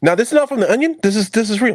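high, loud and excited. Real, not acted. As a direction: announce it like big news.

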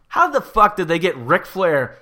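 usual speaking voice

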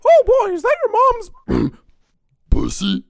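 very high, slow falsetto; high put-on voice.

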